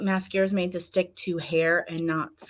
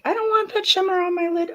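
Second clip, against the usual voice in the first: high, light inner voice; inner voice quote; 11 semitones higher than usual. Retold or imitated. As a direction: squeak it out like a thought. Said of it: "I'm like".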